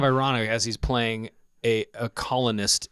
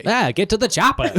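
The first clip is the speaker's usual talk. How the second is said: Arnold quote; 9 semitones higher, 4 dB louder than usual. Retold or imitated. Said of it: "Arnold line after dubbing talk".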